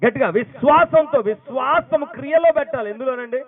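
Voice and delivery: high, loud preacher's cry; fiery exhortation. Real, not acted. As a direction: call it out to the whole church. (బిగ్గరగా ఉద్బోధ)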